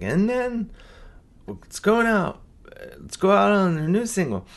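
high, announcer-style outro; lightly voiced radio DJ. Shown as a demonstration. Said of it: "I'll say it like a D J".

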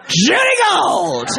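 slow, drawn-out proud shout of the name. Retold or imitated. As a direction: sell the name like an announcer. Agent shouts client's name to casting.

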